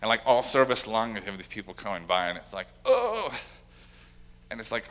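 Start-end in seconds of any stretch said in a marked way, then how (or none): 2.8-3.4 high-pitched, falling "oh" of dismay; put-on moan of discomfort; mock groaning "oh". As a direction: imitate an exasperated "oh" that starts way up high. Acted reaction to latecomers passing.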